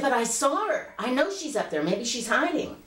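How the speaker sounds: put-on high little-girl protest; pleading child. Child arguing with her irritated mother, pleading.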